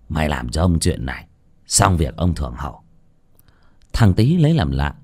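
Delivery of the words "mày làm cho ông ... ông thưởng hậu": trầm và nhỏ, như mặc cả kín; hạ giọng hứa thưởng; thấp giọng dụ dỗ. Acted. (cụ Lý hạ giọng dụ thằng Tý, thì thầm dụ dỗ)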